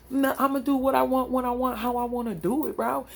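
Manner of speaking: cocky younger self. Retold or imitated. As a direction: play her brash past self who answered to no one. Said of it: "the confident [name] would".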